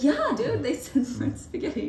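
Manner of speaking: high laughing reply; laughing exasperated reply to the manager; laughing exasperation. Retold or imitated. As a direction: laugh through it in disbelief. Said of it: "And I was like".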